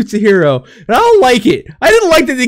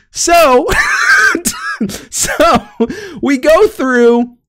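laughing fit; 7 semitones higher, much slower than usual. Real, not acted. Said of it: "I'm learning out of breath".

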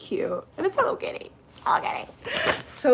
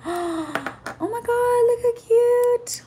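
higher and slow, awed and delighted; slow awed gush; 11 semitones higher, slower than usual. Real, not acted. Showing gifted hair clips to viewers, slow awed delight. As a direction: stretch it out in awe.

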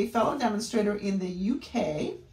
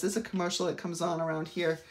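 normal talking voice

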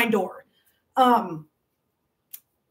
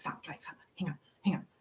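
whispered plea to stop; hushed aside; 8 semitones lower, 15 dB quieter, faster than usual. Real, not acted. Streamer stopping her chatty viewers, whispered. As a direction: say it under your breath, barely audible. Shushing chatters while getting sidetracked.

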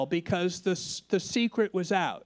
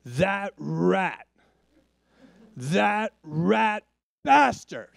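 slow, heavy growl of contempt; loud muttered curse; 2 semitones higher, 5 dB louder, much slower than usual. Retold or imitated. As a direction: spit out the insult like an angry old friend. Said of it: "muttered in a loud voice".

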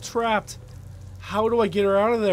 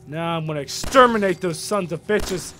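usual speaking voice

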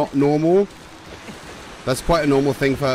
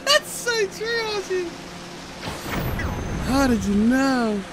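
high, slow, sweet princess voice; sing-song cartoon princess; Disney princess voice; 3 dB quieter, slower than usual. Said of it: "A Disney princess voice?".

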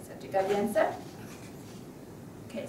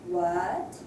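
"What" swept upward from low; rising tone; 4 semitones lower than usual. Shown as a demonstration. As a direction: ask "What?" with a clear climbing pitch. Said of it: "it's a rising tone".

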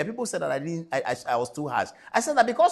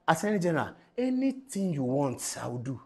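mock pleading quote; 6 semitones lower, 5 dB quieter than usual. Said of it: "He has written a letter".

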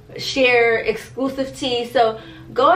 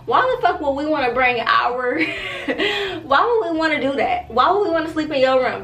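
higher, laughing through the question; laughing rant; 5 semitones higher than usual. Real, not acted. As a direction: rant until you crack up. Ranting, breaks into laugh.

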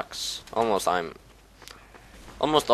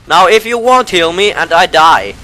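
higher, louder bossy threat; loud demand; 8 semitones higher, 11 dB louder than usual. Real, not acted. Gamer loudly threatens the medic to heal him.

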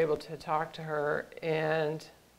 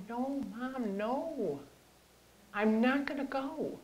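higher, softer voice of a refusing son; imitating a son's soft, high protest; retold pleading refusal; 7 semitones higher, 4 dB quieter, slower than usual. Quotes son refusing treatment.

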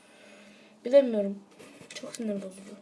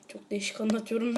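ordinary talk

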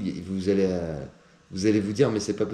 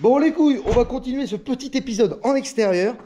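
calling out; 13 semitones higher, 5 dB louder than usual. Real, not acted. Calling out to viewers outdoors.

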